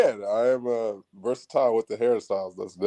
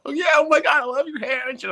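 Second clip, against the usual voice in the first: high, squealing, excited fangirl; gushing girl voice; 4 dB louder than usual. Retold or imitated. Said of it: "They're like".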